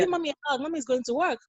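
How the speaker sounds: a mother pleading with her son for a hug; coaxing mommy voice. Retold or imitated. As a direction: coax the little boy sweetly for a hug.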